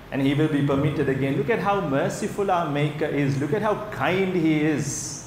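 usual speaking voice

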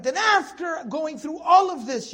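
AFTER and ALL stretched high and loud; exclamatory emphasis. Shown as a demonstration. Teacher rendering the verse for students, exclamatory emphasis on AFTER and ALL. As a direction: lean hard on "after" and "all", pitch lifted. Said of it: "And adds an exclamation mark".

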